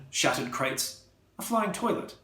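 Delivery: ordinary delivery, nothing marked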